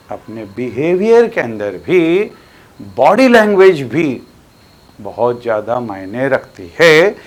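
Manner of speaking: ordinary talk, nothing marked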